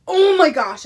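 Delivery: loud, high burst of delight; real thrilled exclamation; excited outburst. Real, not acted. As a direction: blurt it out loud, bowled over. Bursts out at the smell.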